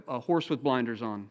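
ordinary talk

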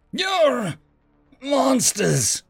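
high, outraged cry. A narrator's reading. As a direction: spit it out in horror. Warlord outraged at specialist.

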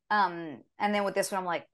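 ordinary talk, nothing marked

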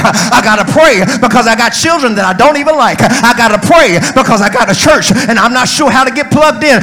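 insistent rhythmic sermon refrain; full-force preaching. Real, not acted. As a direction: hammer the refrain in a preacher's cadence.

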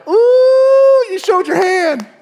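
high, drawn-out whoop, then the taunt; gleeful taunt. Retold or imitated. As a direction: crow it loud and high, triumphant. Gleeful retort to the lying spirit.

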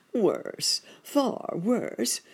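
put-on high, pained cry of the old rabbit. A narrator's reading. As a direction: moan it as the worst news. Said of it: "cried Uncle Wiggily".

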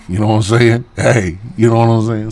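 loud hype. Real, not acted. Hyping the crowd.

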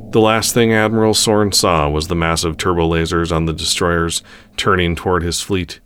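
ordinary delivery, nothing marked

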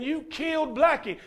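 lightly voiced child's wail, pitch up high; a hint of a heartbroken boy's cry; retold boy screaming. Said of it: "he was screaming at that man".

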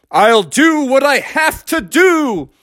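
high, slow, drawn-out declaration; hammed-up resolve; heroic defiance. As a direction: proclaim it like a determined hero. Frog defies the warnings.

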